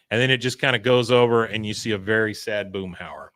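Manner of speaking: normal talking voice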